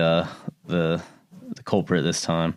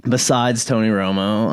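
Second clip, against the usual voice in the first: ordinary talk, nothing marked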